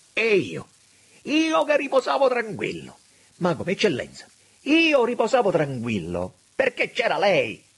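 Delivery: high, loud agitated outcry; frantic reproach. A narrator's reading. Auriti trusted the minister, frantic reproach. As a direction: cry out in agitation. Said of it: "smaniando, torcendosi le mani".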